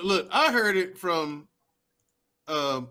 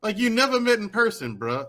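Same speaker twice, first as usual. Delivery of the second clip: usual speaking voice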